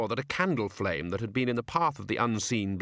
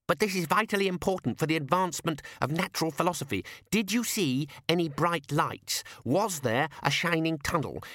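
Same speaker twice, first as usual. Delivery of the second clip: put-on eager young scholar; 7 semitones higher than usual. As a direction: press each question like a keen researcher. Ponder questions the revived man.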